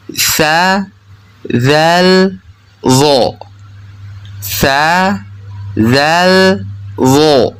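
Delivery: sa, zal, zo with tongue tip on upper teeth edges; lisped TH sounds in "sa, zal, zo", the last one thick; name the letters "sa, zal, zo" slowly with tongue between teeth; interdental letters. Shown as a demonstration. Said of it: "ujung lidah yang menempel pada ujung gigi seri atas".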